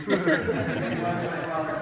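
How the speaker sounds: laughing. Real, not acted. Joke about dad and Darth Vader.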